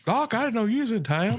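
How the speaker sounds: high laughing put-on Western voice; Tombstone quote impression. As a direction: deliver the saloon greeting hammed up, laughing. Quoting Tombstone line.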